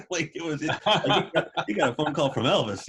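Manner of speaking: can barely get it out for laughing; laughing while talking. Real, not acted. Laugh heard on these lines.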